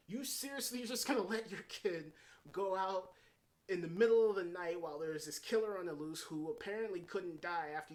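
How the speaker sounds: can you believe this parent, push it up; incredulous. Real, not acted. Reviewer questions a mother letting her daughter go, with loud disbelief.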